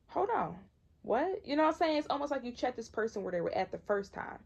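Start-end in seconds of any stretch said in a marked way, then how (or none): 0.1-1.4 higher, incredulous stop; shocked refusal. Retold or imitated. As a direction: cut them off in disbelief, pitched up. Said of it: "But you're like".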